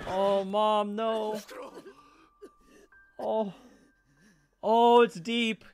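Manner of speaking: high, pitying moan; pained dismay. Real, not acted. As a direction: moan in pain for her. Watching the mother's wound.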